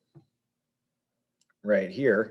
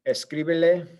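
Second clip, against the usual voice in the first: escríbele lifted higher on CRI; stress kept on CRI; 6 semitones higher, 4 dB louder than usual. Shown as a demonstration. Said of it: "just to maintain the stress".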